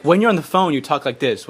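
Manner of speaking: normal talking voice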